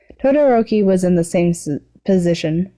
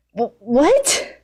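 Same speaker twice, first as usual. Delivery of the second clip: sputtering; 6 semitones higher than usual. A narrator's reading. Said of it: "sputtered, and sputtered".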